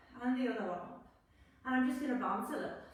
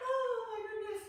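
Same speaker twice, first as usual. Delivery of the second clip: bursting into laughter; laughing; 8 semitones higher, 4 dB louder than usual. Real, not acted. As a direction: laugh through the words. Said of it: "bless you".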